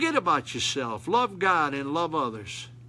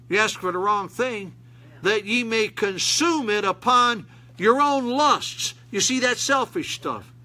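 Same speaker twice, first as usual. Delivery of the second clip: raised, fervent preacher voice; preaching; 6 semitones higher, 3 dB louder than usual. Acted. Preacher pressing the church, fervent. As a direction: preach it out loud to the congregation.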